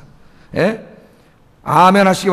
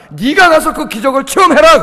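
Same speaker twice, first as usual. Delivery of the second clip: higher, louder divine order; preacher answering complainers with God's loud order; forceful command; 6 semitones higher, 5 dB louder than usual. Acted. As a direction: throw the command at doubters. God's answer quoted, raised voice.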